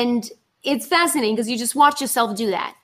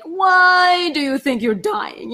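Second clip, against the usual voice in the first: mock dramatic; 7 semitones higher, 6 dB louder, slower than usual. Questioning own drama.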